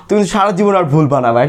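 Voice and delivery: normal talking voice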